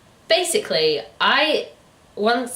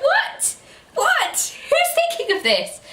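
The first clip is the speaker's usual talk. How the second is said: high squeaky what what; shrill disbelief at the plot; incredulous; slower than usual. Real, not acted. Reviewer reacts to the absurd plot, very high incredulous.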